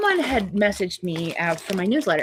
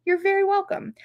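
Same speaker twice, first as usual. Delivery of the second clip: "You're very welcome." said with voice, much higher; bright high reply. Real, not acted. Answering a viewer's thanks again, voiced and high.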